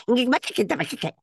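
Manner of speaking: put-on high, garbled caricature of a Mexican accent; mock accent impression. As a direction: imitate an absurdly thick Mexican accent, pitched high. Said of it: "exactly how it should sound".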